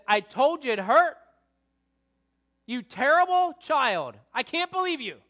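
hammed-up furious parent rant; mock angry father. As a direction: yell at the child like a harsh dad. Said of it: "Is that what I did?".

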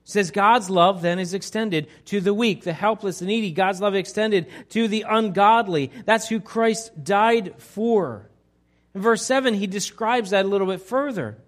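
loud, driving sermon delivery; forceful preaching. Acted. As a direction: preach it out to the whole church.

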